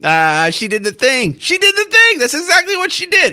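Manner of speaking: delighted told-you-so squeal; gleeful. Real, not acted. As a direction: crow about being right. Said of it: "gleeful vindication".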